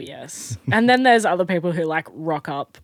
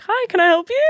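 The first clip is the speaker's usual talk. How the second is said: high, louder polite greeting; customer-service voice; 14 semitones higher, 5 dB louder than usual. Retold or imitated. Worker to a man who grabbed a cup, high customer-service voice. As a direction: imitate a put-on helpful shop greeting.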